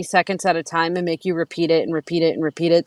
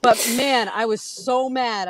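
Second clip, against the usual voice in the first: usual speaking voice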